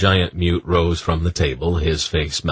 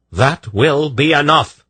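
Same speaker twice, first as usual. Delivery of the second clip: louder, higher, slow stern command; commanding crack; 10 semitones higher, 6 dB louder, slower than usual. A narrator's reading. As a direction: stop them cold. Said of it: "Polgara's voice cracked".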